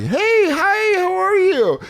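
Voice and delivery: very high, chirpy phone voice. Shown as a demonstration. Caller greeting strangers outside his circle, high phone voice. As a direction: put on a squeaky-polite telephone greeting. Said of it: "I use phone voice".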